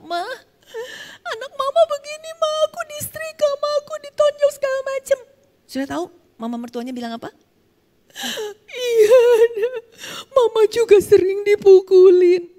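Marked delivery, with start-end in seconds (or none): 0.1-5.2 high, crying plea to mother-in-law; tearful plea. Retold or imitated. Wife crying to her mother-in-law about the beatings, tearful. 8.6-12.5 loud, high, tearful confession; crying admission. Retold or imitated. Mother-in-law tearfully admitting she is beaten too, crying.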